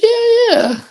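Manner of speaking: very high, squeaky yeah yeah; hammed-up girlfriend brush-off; mocking her yeah. Mimicking his girlfriend's casual answer, very high mock.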